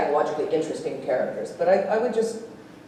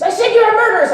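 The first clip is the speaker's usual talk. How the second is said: high, loud insistent repeat; hammed-up shouted accusation; mock yell; 8 dB louder, faster than usual. Quoting co-star repeating line.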